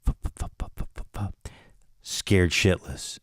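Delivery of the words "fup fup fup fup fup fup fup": breathy fup fup of soft steps; hushed imitation of movement in the dark; imitated rustling. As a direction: puff out muffled footsteps.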